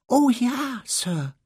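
earnest, high squeaky elf; Dobby. A narrator's reading. Elf confirms his reason for visiting, earnest squeak. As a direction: squeak it solemnly, loud then dropping. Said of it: "sagte Dobby mit ernster Miene".

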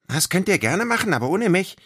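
calling out. Acted. Little creature refusing the game, calling out high. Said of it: "rief Eddie".

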